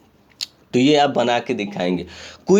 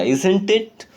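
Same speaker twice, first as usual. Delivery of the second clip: "Isn't it?" pitched higher, rising at the end; stressed rising tag; 5 semitones higher than usual. Shown as a demonstration. Teacher demonstrating stress on the tag, rising higher. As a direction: give "Isn't it?" a stressed, lifting rise. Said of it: "थोड़ा सा stress देना पड़ेगा".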